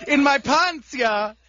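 put-on comic stock Swedish accent. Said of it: "Johnny Sweden".

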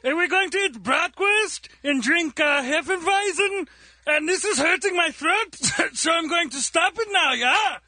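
put-on comic German accent. Radio comic playing Johnny Germany for the hosts, German accent. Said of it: "Johnny Germany".

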